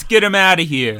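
loud. Real, not acted. Mock rant at hats.

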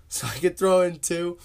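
laughing through the words; breaking into laughter mid-line. Real, not acted. Cracks up at own joke.

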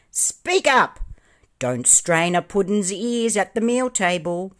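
loud, high-pitched indignant order; angry shout. A narrator's reading. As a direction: raise the voice and scold them crossly. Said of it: "shouted the pudding angrily".

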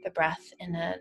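calm, level-toned breath-by-breath guidance; guided meditation. Real, not acted.

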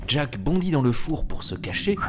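usual speaking voice

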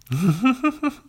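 sudden high burst of laughter. Real, not acted. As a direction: break into a loud high laugh. Laughs at own joke.

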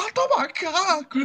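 put-on angry kid shout. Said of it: "Ele puto, falou".

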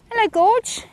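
high, affectionate hello to an animal; fond pet greeting. Real, not acted. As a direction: coo a greeting to your horse. Greeting her horse fondly.

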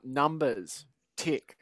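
normal talking voice

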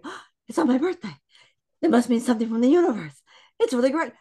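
high, loud, fast gush of wonder; acted excitement. Guest mimicking her birthday thoughts, excitedly. Acts out own thrilled inner voice.

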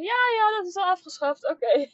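put-on high, offhand teenager voice; breezy know-it-all kid. Children answering, high voice.

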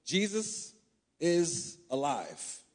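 Jesus then a pause, is alive drawn out very slowly; slow emphatic proclamation. Shown as a demonstration. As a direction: let "Jesus is alive" land slowly, word by word. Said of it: "she said what our ministry is".

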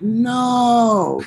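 mock despair at the passed-out drummer; exasperated disbelief.

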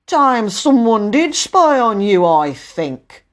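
loud, slow rebuke, easing at the end; lightly voiced scolding official; stern station master.

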